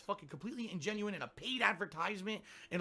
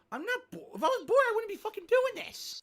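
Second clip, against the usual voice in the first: squeaky high whiny voice; high-pitched girl voice; 6 dB louder than usual. Retold or imitated. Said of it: "High-pitched girl voice".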